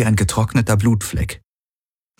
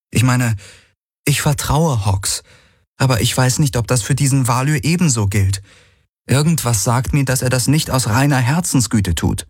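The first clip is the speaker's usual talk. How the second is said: higher voice, doubtful and worried; uneasy young woman; 6 semitones higher than usual. A narrator's reading. Kirai tells Galen her doubts about Valyr, higher and uneasy. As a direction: voice her misgivings in a raised, uneasy tone.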